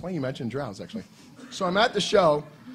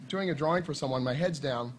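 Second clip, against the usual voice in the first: ordinary talk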